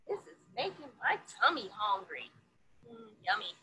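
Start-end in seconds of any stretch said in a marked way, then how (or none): none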